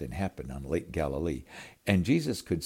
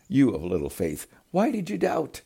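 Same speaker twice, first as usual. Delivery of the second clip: Jesus rebuking; 8 semitones higher, 5 dB louder than usual. Acted. Jesus gently rebukes Peter.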